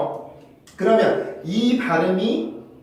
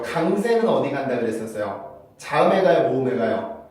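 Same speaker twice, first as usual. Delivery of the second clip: normal talking voice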